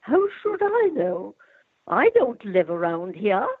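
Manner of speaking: put-on haughty upper-class British accent, pitch swooping high; highbrow British Duchess. Performer showing children her favourite character, highbrow British Duchess accent. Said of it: "is the highbrow British Duchess".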